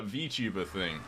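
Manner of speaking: ordinary talk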